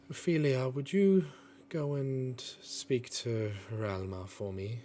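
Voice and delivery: lightly voiced character making a slow, gentle request. She asks her little star chick to carry a message, gentle character voice. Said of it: "and then she just goes".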